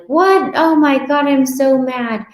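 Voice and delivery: high, loud mock dismay; mock shock. Dieter seeing the scale jump after a free day, loud mock shock. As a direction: gasp at the scale, then fume playfully.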